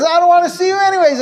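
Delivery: high, fast mock yell of a sulky kid. Son yelling at his father before slamming the door, in a high mock yell. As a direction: fling it back at dad before slamming the door. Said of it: "And the kid says".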